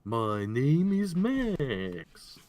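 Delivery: slow, booming show intro; drawn-out host announcement; announcer voice. Acted.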